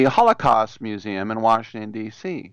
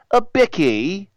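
quick mock English accent on "a bicky", higher and slower; 7 semitones higher than usual. Host mocking the English word for biscuit in an English accent. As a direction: toss off "a bicky" like a posh Brit. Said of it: "Or as they say in England".

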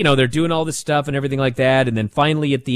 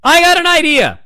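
much higher, louder bright announcement; hammed-up Ava with an idea; Ava's idea; 13 semitones higher, 7 dB louder than usual. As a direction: pitch the idea grandly. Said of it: "And Ava goes".

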